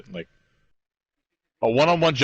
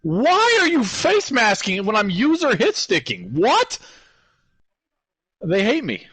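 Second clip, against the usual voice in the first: high, slow, loud disbelief at the game; exasperated outburst; 10 semitones higher, 4 dB louder, slower than usual. Real, not acted. Gamer yelling at a face mask penalty, outraged. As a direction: drag out the complaint, then spike the what.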